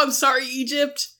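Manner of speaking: ordinary talk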